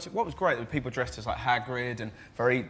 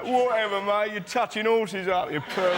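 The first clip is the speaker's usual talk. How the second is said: swaggering Londoner; 7 semitones higher than usual. Retold or imitated. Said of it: "swaggering Londoner".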